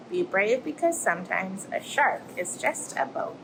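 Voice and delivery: lively storybook voice for little ones; reading to children. Acted. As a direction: tell it like a bedtime tale, rising and falling. Reads picture book to children.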